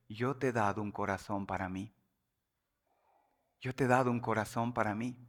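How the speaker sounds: soft and low, like a thought in the heart; the Lord's quiet voice. Acted. The Lord speaking to the preacher's heart, quiet and low. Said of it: "para decir el Señor".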